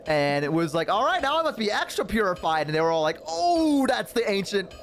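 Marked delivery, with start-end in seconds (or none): 0.9-2.7 raised, pleased-with-himself voice; flippant in-character quip. Acted. Human after splashing the sacred basin, cheekily flippant. 3.3-4.7 high, aghast elf outcry; mock horror. Elves watching a human defile their basin, mock-horrified.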